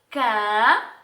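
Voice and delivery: "ca" loud and held long on its own; isolated syllable. Shown as a demonstration. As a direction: stretch "ca" as one separate part of a word. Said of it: "Falando parte por parte".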